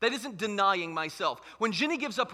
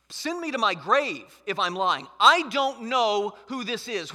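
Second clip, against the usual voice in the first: louder and slower as the denial lands; emphatic denial; 5 dB louder than usual. Acted. Peter renounces Jesus while people look on, emphatic denial. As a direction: renounce him emphatically, stretching the final words. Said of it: "he emphatically renounces".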